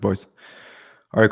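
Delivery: ordinary talk, nothing marked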